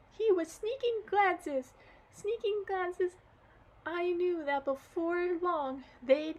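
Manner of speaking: acted girl sobbing to her granddad; crying girl. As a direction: blubber it out through tears. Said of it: "got all worked up and started crying again".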